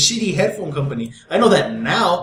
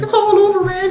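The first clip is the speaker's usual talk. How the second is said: much higher, hammed-up despair; mock panic. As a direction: wail it like a mock doomsday. Acting out network's reaction to disaster.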